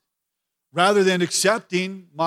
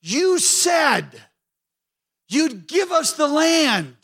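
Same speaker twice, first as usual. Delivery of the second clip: anguished Joshua; 5 semitones higher than usual. Retold or imitated. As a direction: cry out to God as a shaken Joshua, stretching the words. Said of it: "He's like".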